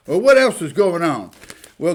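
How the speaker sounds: usual speaking voice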